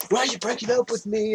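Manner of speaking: high-pitched imitation of a jilted man; hammed-up lovesick protest; mock confrontation. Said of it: "goes up and confronts her".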